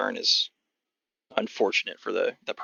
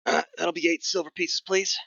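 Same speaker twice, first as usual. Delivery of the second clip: higher-pitched gruff goblin voice; gruff old goblin; 6 semitones higher than usual. Acted. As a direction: play a crusty old goblin selling tickets. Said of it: "Sort of this gruff old goblin".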